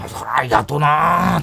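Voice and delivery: slow Osaka dialect imitation; old comedian's Kansai drawl; Kansai accent. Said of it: "バリバリの関西弁".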